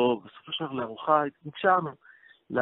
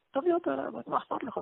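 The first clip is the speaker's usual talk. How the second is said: pitched way up, pressing him to bring her; eager urging; 3 dB quieter, faster than usual. Retold or imitated. Rabbi urging the groom to bring his fiancée over, excited and much higher. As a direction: coax him insistently, voice jumping higher.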